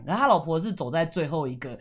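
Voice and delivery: ordinary delivery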